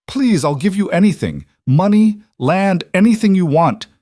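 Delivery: high, loud, panicked pleading; desperate begging. A narrator's reading. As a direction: beg for your life. Traitor begging for his life.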